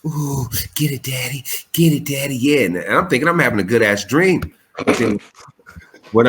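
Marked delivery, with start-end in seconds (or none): 0.1-2.4 a lightly voiced sultry moan; sexy female voice. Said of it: "this little sexy voice".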